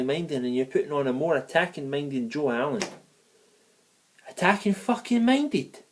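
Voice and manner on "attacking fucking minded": high, slow, scoffing disbelief; scornful mockery. Real, not acted. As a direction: stretch it out in disbelief, much higher. Scoffing at commentator's phrase.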